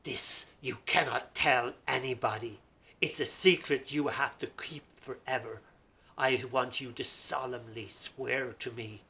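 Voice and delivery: loud, firm royal command; stern king. Acted. King warning the barber to keep the secret, stern loud order. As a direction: lay down the law, solemn and stern. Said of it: "sternly looked at him and says".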